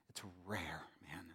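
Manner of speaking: breathy hushed aside; whispered lament. Real, not acted. Preacher lamenting to the congregation, a soft whisper. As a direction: sink into a whisper.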